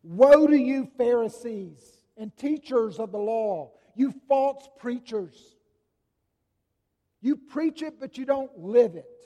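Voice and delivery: loud, high denunciation; proclaiming woes. Acted. Jesus denouncing the Pharisees and teachers, loud and fierce. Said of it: "he proclaims what has become known as the woes".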